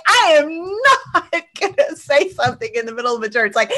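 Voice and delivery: high, laughing mock protest. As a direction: giggle through the objection. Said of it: "And I'm like".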